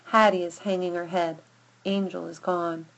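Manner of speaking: usual speaking voice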